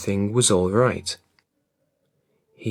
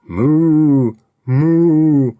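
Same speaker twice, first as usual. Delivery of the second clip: loud, higher, drawn-out moo; loud lowing moo; cow moo; 4 semitones higher, 7 dB louder than usual. A narrator's reading. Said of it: "and the cow mooed".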